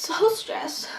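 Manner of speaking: high, unvoiced whisper. Real, not acted. Whispering how stressed she was before the concert. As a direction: whisper it like a secret.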